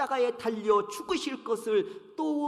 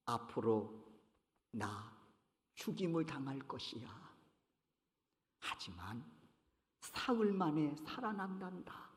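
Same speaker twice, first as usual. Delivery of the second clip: low, much softer, grave voice; hushed solemn foretelling; 8 semitones lower, 11 dB quieter than usual. Retold or imitated. Jesus quietly tells the disciples his death and rising. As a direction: speak it low as a secret of great weight. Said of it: "아주 중요한 사실을 알려주십니다".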